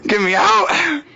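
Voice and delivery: mock desperate cry from the crib; desperate baby plea. Baby trapped in crib begs out.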